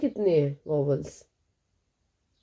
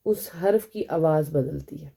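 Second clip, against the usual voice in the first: ordinary talk, nothing marked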